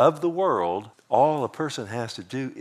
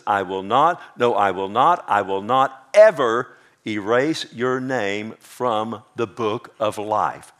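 slow, louder "I will not" with every "not" pressed hard; emphatic promise; 2 semitones lower, 4 dB louder, slower than usual. Shown as a demonstration. Preacher to congregation, slow emphatic "I will not". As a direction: drive home "I will not", slow and louder each time. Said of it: "Let me read it this way".

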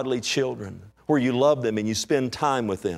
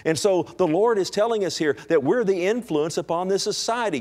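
full-force preaching; 6 semitones higher than usual. Acted. Preacher urging the church to be salt, full force.

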